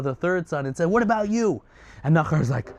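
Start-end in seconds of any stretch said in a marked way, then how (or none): 0.9-1.6 screamed challenge. Acted. Nimrod challenging the third son, screamed challenge. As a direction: round on him with a high-pitched demand. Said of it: "Nimrod screams and looks at Nachor".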